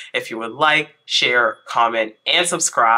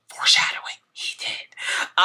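hushed, teasing whisper; 6 dB quieter than usual. Acted. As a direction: drop to a secretive whisper. Dramatic aside about spoiler.

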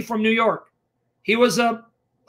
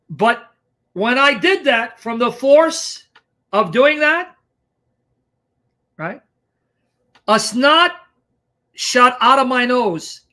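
ordinary talk

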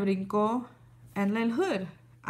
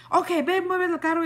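higher, louder bossy order; angry neighbor; 7 semitones higher, 3 dB louder than usual. Retold or imitated. Retelling the neighbor insisting she move the car, higher and bossy. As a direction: imitate the neighbor barking at her.

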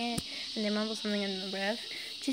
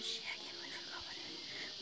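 whispered frustrated aside; 12 dB quieter than usual. Real, not acted. Narrator who forgot the answer, whispering to himself. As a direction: hiss it under your breath. Aside after drawing a blank.

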